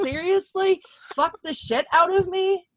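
high-pitched mock disbelief; incredulous. Woman questions a crude pickup line, incredulous. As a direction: echo the offer back as a high, baffled question.

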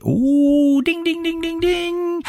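high-pitched "ooh, ding-ding-ding" chime; imitated alert bell. Shown as a demonstration. Host heralding the word of the day, high imitated bell. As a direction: sing out a very high string of dings.

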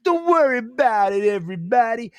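a hint of a smooth movie star reassuring a kid; John Travolta impression. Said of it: "that's how he sounds to me".

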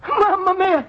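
high, shocked exclamation; dismayed cry. Acted. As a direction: gasp it out in dismay. Finds his money missing.

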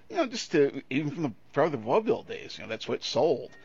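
ordinary delivery

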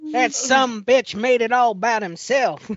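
slow hammy southern drawl; Mississippi accent. Pretend. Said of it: "It's about time I do it to somebody else".

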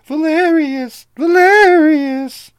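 long, high, loud calls stretched out slowly; desperate call. Acted. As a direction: call his name from afar in desperate urgency. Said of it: "a voice calling his name in desperate urgency".

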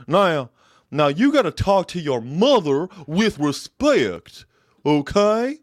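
high, loud Texan talk-show scold; Dr. Phil scolding. Retold or imitated. TV host scolding a guest about respecting his mother, high and loud.

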